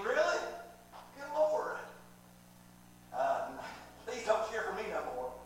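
normal talking voice

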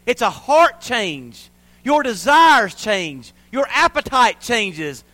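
loud, drawn-out declarations; emphatic preaching. Real, not acted. Pastor telling the church salvation is a change, emphatic preaching. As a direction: preach it out, hammering each change.

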